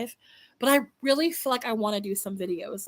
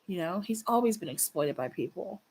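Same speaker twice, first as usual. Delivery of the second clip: ordinary delivery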